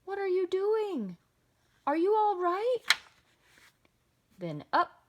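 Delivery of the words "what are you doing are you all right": high, loud questions called out; worried yell. A narrator's reading. Boy checking on Mister Carp underwater, yelling. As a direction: shout down to someone, growing louder. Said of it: "I yelled".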